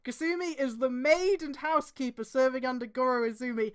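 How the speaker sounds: normal talking voice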